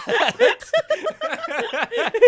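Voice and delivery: loud, shrieking laugh; high laugh. Real, not acted. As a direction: cackle up high. Big laugh.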